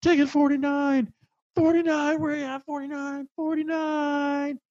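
bellowing the ticket number at the crowd; calling out. Retold or imitated. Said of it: "hands cupped over her mouth, and she's like".

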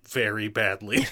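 dry, flat imitation of Ryan; deadpan Ryan voice. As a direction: say it utterly deadpan. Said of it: "just deadpan Ryan voice".

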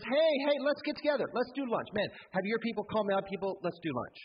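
high, eager salesman voice inviting to lunch; smarmy go-getter calling up to the wall; schmoozy messenger. Acted. Messenger voiced in retold Bible scene.

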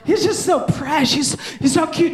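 high gushing mock mom voice; gushing wife. Wife gushing over the baby, high and cooing. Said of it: "But she".

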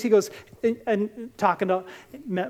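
ordinary talk, nothing marked

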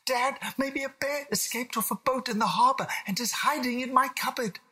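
put-on very high, excited child voice; a small boy spinning a scary theory; alarmed little William. William tells dad about a hiding bear, alarmed child voice.

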